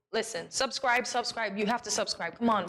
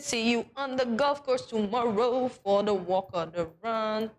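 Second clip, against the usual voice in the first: ordinary delivery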